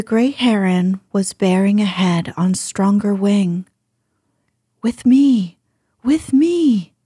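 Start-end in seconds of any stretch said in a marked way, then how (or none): 4.8-5.6 calling his mate, slightly louder; urgent call to follow; urgent cry. Acted. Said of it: "he cried". 6.0-6.9 pitched up high, pleading; higher desperate cry. Acted. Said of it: "he cried".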